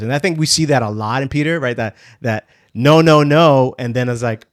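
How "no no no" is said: loud, insistent acted objection; imitating a stubborn refusal at raised volume; mock refusal. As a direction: push back hard, louder on each word. Acts out Peter's refusal.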